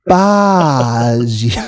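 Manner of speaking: drawn-out fake French pronunciation; mock French accent. Explaining where the meme came from, mock French accent. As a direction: stretch it into "gar-baahge" like a Frenchman. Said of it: "like a French guy".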